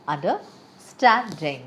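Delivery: "Understanding" drawn out slowly, pausing before a stressed STAND. Shown as a demonstration. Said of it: "And the correct way is".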